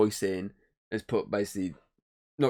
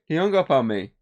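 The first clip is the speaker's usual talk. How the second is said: higher, louder imitated operator; mock operator; 6 semitones higher, 5 dB louder than usual. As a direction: complain as the operator. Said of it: "She's like".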